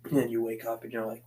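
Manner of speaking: normal talking voice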